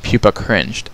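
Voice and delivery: plain reading voice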